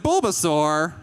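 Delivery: high, loud squawk of disbelief; incredulous kid. Acted. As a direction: protest the wrong answer in outrage. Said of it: "and you're like".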